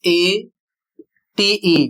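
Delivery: each letter drawn out, A-T-E; slow spelled-out letters; spelled out slowly. Shown as a demonstration. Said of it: "या फिर".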